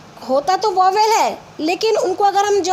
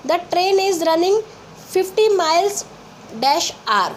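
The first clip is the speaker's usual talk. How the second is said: slow, word by word reading; slow dictation; 2 semitones higher, much slower than usual. Shown as a demonstration. Teacher dictating an example sentence. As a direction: read it out slowly. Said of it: "जैसे देखिए".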